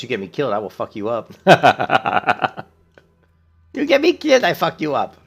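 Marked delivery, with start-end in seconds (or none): 1.4-2.6 loud laugh. Real, not acted. Laughing at own joke. 3.7-5.1 much higher, louder, comic threat; squeaky mock threat. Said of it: "repeats the threat".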